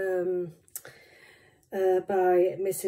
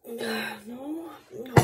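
high whiny wail of defeat; crying sigh; 10 semitones higher, 9 dB quieter than usual. Real, not acted. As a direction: whimper it in frustration. Struggling to open jar.

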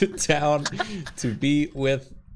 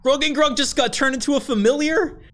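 surprised; 13 semitones higher, 5 dB louder than usual. Real, not acted. Shocked at the story twist.

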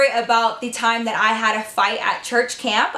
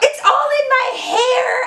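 put-on much higher and louder, slow whining girl; whiny shrill girl; 13 semitones higher, 4 dB louder, slower than usual. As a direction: wail it like a spoiled girl with sand in her hair. Lisa whining about sand.

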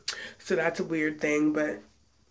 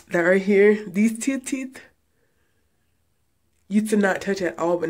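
speaking with finger in mouth; finger pulling lip down; 3 semitones higher, 7 dB louder than usual. Real, not acted. Seen 2: Finger holds lower lip down showing braces; gone after 4.0s.

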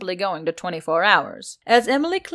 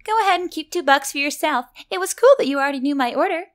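high, bubbly customer voice, faster at the end; cheery centaur; 7 semitones higher, 4 dB louder, faster than usual. A narrator's reading. Centaur tipping the barista, high bubbly cheer. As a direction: sound like an eager, happy customer.

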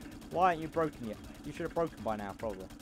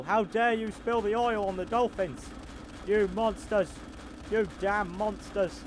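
mock outrage; 7 semitones higher, 5 dB louder, much slower than usual. Mock outrage over dolphins.

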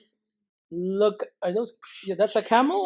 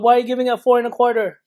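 calling out; 4 semitones higher, 8 dB louder, faster than usual. Real, not acted. Calling out to child.

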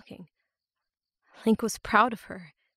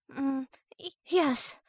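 put-on high, faltering girl's stammer; shaky frightened girl; 7 semitones higher, slower than usual. A narrator's reading. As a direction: stumble out the yes in fear. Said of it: "her breathing never steadied itself".

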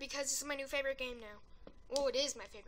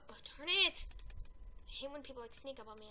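ordinary delivery

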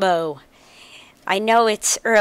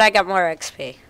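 usual speaking voice

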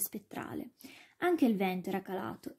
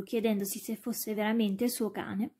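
plain reading voice

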